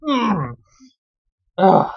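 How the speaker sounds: plain reading voice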